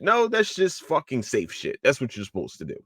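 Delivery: ordinary talk, nothing marked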